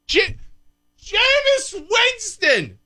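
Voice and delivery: way up high, stretched out slowly in disbelief; incredulous exclamation. Real, not acted. Host exclaiming the player's name in disbelief, high and drawn out. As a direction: cry the name out like you can't believe it.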